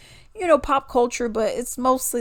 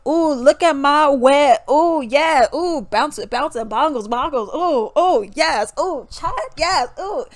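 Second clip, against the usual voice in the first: loud, exaggerated moaning hype; mocking rapper ad-libs; 4 semitones higher, 6 dB louder than usual. Mimicking raunchy female rappers, loud mocking swoon.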